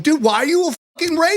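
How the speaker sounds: high indignant mock accusation; a high, hammed-up cry of racism; mock outrage. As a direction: play the offended victim, way over the top. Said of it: "I'm like".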